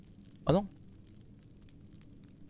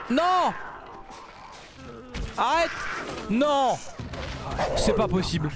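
anguished shouts; 11 semitones higher, slower than usual. Real, not acted. Gamer shouting no at the screen. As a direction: yell no as the fight goes wrong. Animal dies in game fight.

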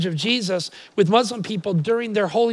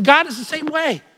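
ordinary delivery

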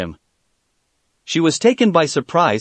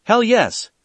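eager inner voice; 7 semitones higher than usual. Acted. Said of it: "her inner Sakura gave an immediate reply".

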